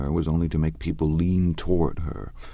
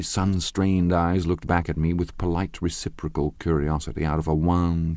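ordinary delivery, nothing marked

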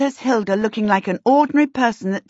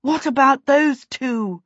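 slow, raised, out-of-breath question; panting; 5 semitones higher than usual. A narrator's reading. Winded pursuer pointing out at the roof, panting. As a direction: ask it while catching your breath. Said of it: "she panted".

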